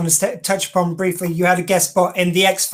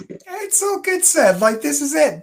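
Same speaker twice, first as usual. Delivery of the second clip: playful reassurance; 9 semitones higher than usual. Acted. Host reassuring guest playfully.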